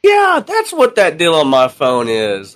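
high, slow dawning-realization stoner voice; imitated stoner. Recruit realizing what Discord is, imitated stoner. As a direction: drag it out as an imitated spaced-out stoner.

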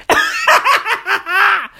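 high, rapid staccato cackle; high laugh. Real, not acted. Host amused by his own implant joke, high cackle. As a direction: cackle in a quick high burst.

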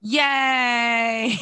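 a sardonic little celebration; sarcastic cheer. Real, not acted. Commentator mocking the bill's demise, sarcastic yay. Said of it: "That's my initial response".

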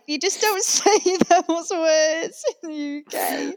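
mock exasperation, cracking up; laughing disbelief. Mocking a celebrity's British slang.

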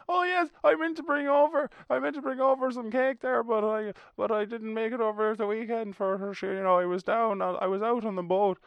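high, loud, friendly country gossip; chatty neighbour. Retold or imitated. Two drivers chatting mid-road, high loud friendly gossip. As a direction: natter on like a neighbour stopped in the road. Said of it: "people just having a chat".

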